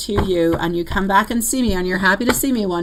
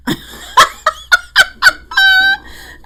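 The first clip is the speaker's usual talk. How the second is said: high laugh; 6 dB louder than usual. Real, not acted. Laughs before retelling.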